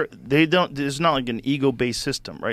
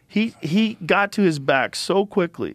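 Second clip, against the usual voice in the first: ordinary delivery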